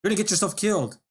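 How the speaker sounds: high, loud warning; shouting. Acted. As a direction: yell at him from the cab. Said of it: "he shouted".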